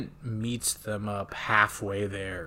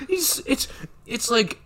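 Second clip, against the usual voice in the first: normal talking voice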